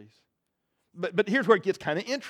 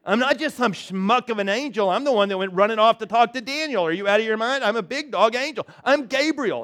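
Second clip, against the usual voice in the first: higher, louder proud angel declaring his rank; a big-shot angel putting a doubter in his place; indignant Gabriel; 5 semitones higher, 4 dB louder than usual. Acted. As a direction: puff up as the offended archangel. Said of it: "see this going".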